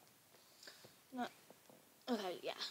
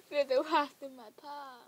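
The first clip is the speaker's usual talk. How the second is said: higher, louder, through laughter; laughing; 4 semitones higher, 9 dB louder than usual. Real, not acted. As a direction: crack up while making the mock threat. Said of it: "I don't know why I'm laughing".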